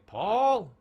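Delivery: loud, high call of a name; mother calling. Retold or imitated. Mother calling her son, loud and high. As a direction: yell it across the house. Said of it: "my mother would call my name".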